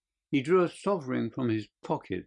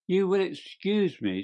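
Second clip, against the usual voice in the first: high-pitched, blandly polite opening; 8 semitones higher than usual. A narrator's reading. Detective approaching the little man, blandly polite. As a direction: interrupt with bland courtesy. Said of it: "said Holmes blandly".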